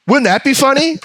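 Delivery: high, goofy put-on pitch; mock writers' voice. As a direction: ask it in a silly high voice, mocking. Still mocking the writers' pitch.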